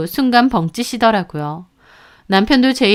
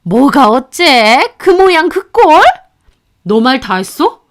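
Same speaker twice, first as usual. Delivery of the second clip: higher, louder, affronted mother-in-law; outraged retort; 3 semitones higher, 5 dB louder than usual. A narrator's reading. Mother-in-law offended by remark about her son, outraged. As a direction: bristle at the insult to your son.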